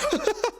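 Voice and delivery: a sharp, high burst of laughter; high exasperated laugh. Real, not acted. Streamer laughs off his rage at the developers, high laugh. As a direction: laugh in disbelief at the absurdity.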